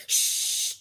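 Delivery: sharp unvoiced shush; hushing shh. Librarian hushing the loud girl, sharp shush. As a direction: hush her right now.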